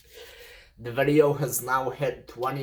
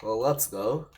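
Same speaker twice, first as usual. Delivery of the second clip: normal talking voice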